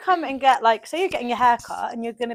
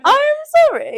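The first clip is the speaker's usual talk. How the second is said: mock crying; 6 dB louder, much slower than usual. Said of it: "I was like".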